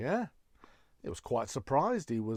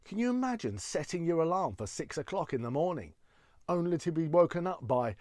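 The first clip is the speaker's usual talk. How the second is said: ordinary talk, nothing marked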